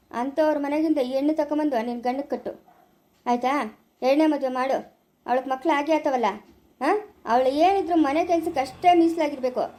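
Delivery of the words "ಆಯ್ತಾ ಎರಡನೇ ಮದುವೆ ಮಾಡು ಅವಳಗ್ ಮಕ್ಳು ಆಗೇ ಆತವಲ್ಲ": ordinary talk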